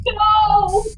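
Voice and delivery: loud, high terrified cry; a cry of horror; horrified no. Acted. Wife seeing the dead mother appear, high terrified cry.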